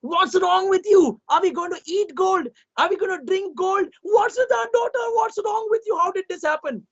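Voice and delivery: queen screaming at the king; queen scolding. A narrator's reading. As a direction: berate the husband. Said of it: "the queen screamed at him".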